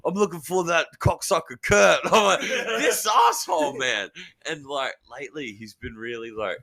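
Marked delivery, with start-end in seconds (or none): 0.1-2.0 higher, louder demanding shout; hammed-up officer hunting a suspect; mock police bust. Mate as police bursting in. 2.4-4.1 high, drawn-out indignant shout; loud, slow, hammed-up outrage; mock exasperation. Retells own exasperated reaction to prank.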